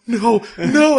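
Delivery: high, loud cries of refusal; hammed-up panicked protest; mock desperation. Retelling Sota trapped by a clingy girl, mock desperation. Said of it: "he's completely trapped".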